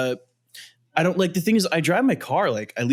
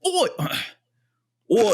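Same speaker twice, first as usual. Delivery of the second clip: a hint of an English accent, higher; 9 semitones higher than usual. Shown as a demonstration. Friends asked for his English accent, first tries a higher Cockney Oi. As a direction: try out a Cockney Oi. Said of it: "your English accent again".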